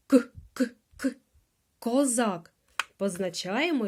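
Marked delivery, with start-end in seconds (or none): none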